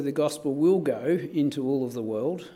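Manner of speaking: ordinary talk, nothing marked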